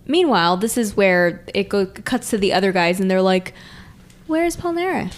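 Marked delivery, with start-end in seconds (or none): 4.3-5.1 high, lightly voiced confused question; a touch of cartoonish puzzlement; mock puzzled question. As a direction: ask it like a baffled sidekick. Voicing the other guys.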